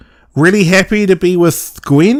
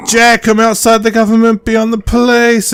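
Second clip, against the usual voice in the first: higher mock villain boast; mocking Susie; 7 semitones higher than usual. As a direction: parody her smug line. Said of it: "she's all like".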